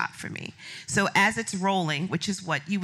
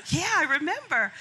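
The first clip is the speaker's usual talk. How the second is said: high, laughing disbelief; 10 semitones higher than usual. Retold or imitated. Guest answering Oprah, high laughing disbelief. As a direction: laugh it out, of course I remember. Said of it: "And I'm thinking".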